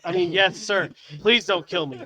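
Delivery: comic scared backpedal; mock panic. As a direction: plead for your life in jest. Retold panicked backpedal to customer.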